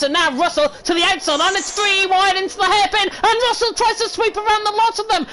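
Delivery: high-pitched, breathless play-by-play climax; excited race commentary. Real, not acted. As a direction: call a stunning overtake as it happens. Live call of a daring overtake.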